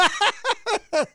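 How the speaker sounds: high burst of laughter; giggle. Real, not acted. Host cracking up over the rival's death, high giggle. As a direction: laugh despite yourself.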